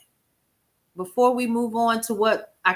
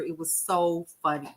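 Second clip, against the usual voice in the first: ordinary delivery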